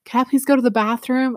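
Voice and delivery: put-on sweet kid asking. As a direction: play the innocent child asking to leave. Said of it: "I'd be like".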